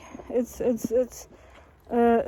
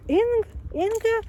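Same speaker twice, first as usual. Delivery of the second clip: incredulous; 10 semitones higher than usual. Retold or imitated. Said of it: "I was like".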